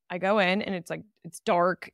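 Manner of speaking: ordinary talk, nothing marked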